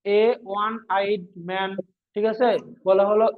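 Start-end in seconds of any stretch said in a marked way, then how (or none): 0.1-1.7 slow example phrase. Shown as a demonstration. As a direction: drag out A one eyed man very slowly. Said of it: "যেমন বলা হলো". (খুব ধীরে উদাহরণ বলা)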